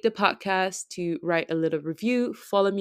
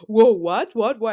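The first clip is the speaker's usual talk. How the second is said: loud panicked whoa, what; loud startled repeated what; freaking out; 3 semitones higher, 8 dB louder than usual. Retold or imitated. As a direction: imitate a guy caught off guard. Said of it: "And he was freaking out".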